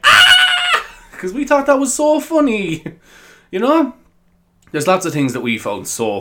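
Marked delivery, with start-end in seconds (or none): 0.0-0.9 high, loud shriek; sudden high shriek of glee; mock shriek of delight. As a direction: squeal it out like a kid laughing. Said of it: "we were like".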